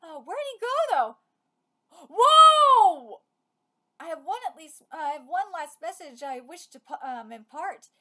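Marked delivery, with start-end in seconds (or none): 2.1-3.2 long high amazed shout; loud whoa. Real, not acted. Player stunned by a giant appearing, shouting. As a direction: let the surprise ring out. Big in-game surprise.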